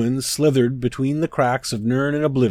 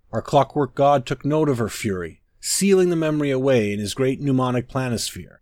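ordinary delivery, nothing marked

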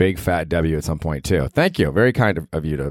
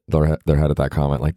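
normal talking voice

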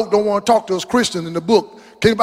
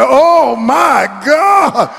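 mock shock; 6 semitones higher, 5 dB louder, much slower than usual.

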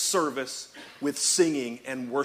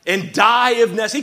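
loud, higher, hammed-up Braveheart accent; rally the men in a terrible Braveheart accent; bad Braveheart accent; 8 semitones higher, 10 dB louder than usual. Preacher imagining a battle speech to frightened men, hammed-up Braveheart accent. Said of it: "I know that was a terrible accent".

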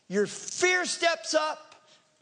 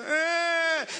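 drawn-out whiny wail; higher mock kid's bawl; imitated child crying; 5 semitones higher than usual. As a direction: wail like one kid starting off. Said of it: "a chorus of children just crying".

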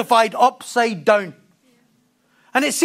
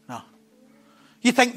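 normal talking voice

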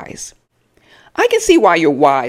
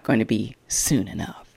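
muttered aside; 7 semitones lower, 8 dB quieter than usual. Real, not acted. Snarky aside dropped low.

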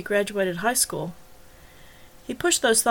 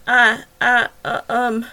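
louder broken stammering; flustered stammer; 7 dB louder, slower than usual. Acted. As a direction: caught out and fumbling for words. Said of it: "Joe was suddenly flustered".

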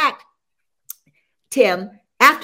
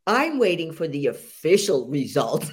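deadpan coach; 8 semitones lower than usual. Retold or imitated. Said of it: "coach says".

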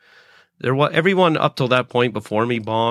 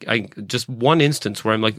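usual speaking voice

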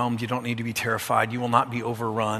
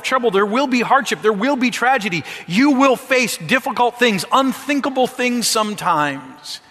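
high, loud, driving sermon voice; full-force preaching; 9 semitones higher, 5 dB louder than usual. Acted. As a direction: preach it hard and fast, building.

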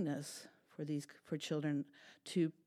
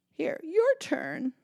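sweet handover; 11 semitones higher, 8 dB louder, slower than usual. Acted. Cat hands over ham.

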